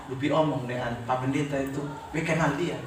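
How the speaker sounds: hushed voice of a weak patient; imitated whisper. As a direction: mimic a patient confiding with little voice. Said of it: "Dia bisik di itu".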